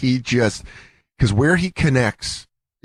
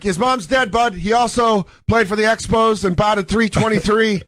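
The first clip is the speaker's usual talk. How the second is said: Canadian heckle; 6 semitones higher than usual. Retold or imitated. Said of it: "He's like".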